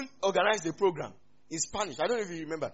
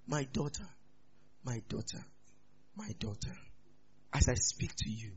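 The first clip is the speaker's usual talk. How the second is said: very soft, halting, oracle-like mother; hushed mock-divine voice, pausing between phrases; mock solemn mom; 5 semitones lower, 9 dB quieter than usual. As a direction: imitate a mother talking like a hushed prophecy. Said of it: "If your mom talks like that".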